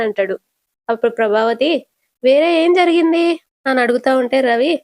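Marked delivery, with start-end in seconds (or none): 2.2-3.2 high-pitched, pressing question; alarmed questioning. Acted. Mother presses her son for what happened, alarmed. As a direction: demand to know, pitch lifted.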